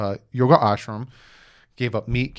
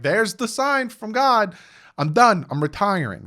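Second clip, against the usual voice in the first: mock relieved proclamation; 9 semitones higher than usual. Quoting relieved Ricky after failed test.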